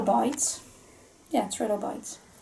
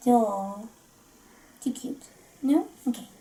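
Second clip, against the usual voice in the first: usual speaking voice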